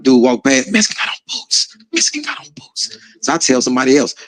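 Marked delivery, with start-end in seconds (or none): 0.7-3.0 urgent, secretive stage whisper; whispered warning. Retold or imitated. Inmate passing another in prison, whispered warning. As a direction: whisper it like a covert alert in passing.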